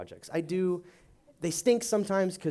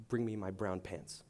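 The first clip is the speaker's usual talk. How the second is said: deadpan punchline; 6 semitones lower, 10 dB quieter than usual. Acted.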